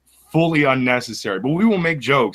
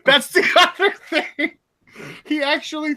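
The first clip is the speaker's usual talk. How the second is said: laughing; 14 semitones higher than usual. Real, not acted. Laugh heard, high pitch.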